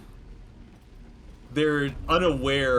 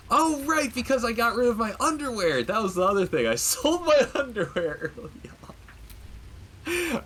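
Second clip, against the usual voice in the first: sudden realization; 12 semitones higher than usual. Real, not acted. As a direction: blurt it out as it dawns on you, laughing. Realizes he sold his underwear.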